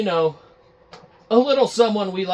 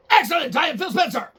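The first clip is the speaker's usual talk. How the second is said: much higher, fast smug sign-off; satisfied Phil Spencer; 11 semitones higher, 3 dB louder, faster than usual. Acted. Phil Spencer smugly ending the call, high and quick. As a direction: wrap it up pleased with yourself. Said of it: "We're gonna do a little reenactment".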